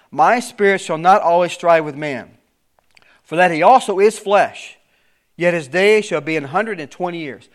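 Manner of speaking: preacher reading the King James text aloud; scripture reading. Acted. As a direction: proclaim the verse with solemn weight.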